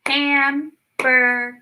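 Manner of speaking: "Hamburger" slow in three beats, HAM-BUR-GER; clapped out by syllable. Shown as a demonstration. Teacher clapping syllables with the kids, three slow beats. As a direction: clap "Hamburger" out, one beat per syllable. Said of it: "Let's try to clap that one out".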